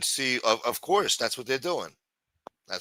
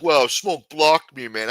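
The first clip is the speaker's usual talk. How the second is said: higher, slow mocking whine; slow, higher, mocking whine of an upset viewer; mock whiny viewer; 4 semitones higher, 7 dB louder, slower than usual. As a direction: parody a sulky complaining viewer. Mocking angry viewers' complaint.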